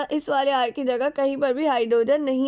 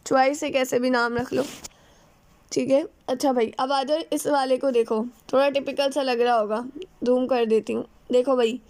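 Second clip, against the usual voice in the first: normal talking voice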